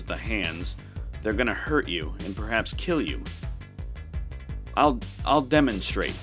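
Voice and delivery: usual speaking voice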